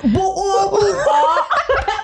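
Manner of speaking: mock glottal-stop Brit voice with a laugh; over-the-top bad British accent; terrible British accent. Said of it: "jokingly have a terrible British accent".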